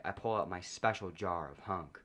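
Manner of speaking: ordinary delivery, nothing marked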